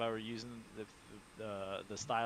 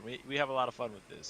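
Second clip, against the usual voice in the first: ordinary delivery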